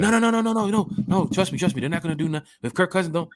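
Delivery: high insistent fan voice; mock fan denial. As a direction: play the fan who won't listen. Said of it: "fan insisting".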